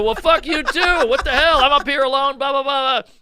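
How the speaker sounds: high-pitched mock tirade; hammed-up, high, flustered outburst; ranting back. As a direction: wail at the crowd, trailing into babble. Acts his rant at crowd.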